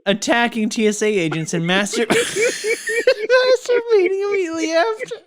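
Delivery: real helpless laugh while talking; cracking up. Real, not acted. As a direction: question breaking into high laughter. Host cracks up mid-question.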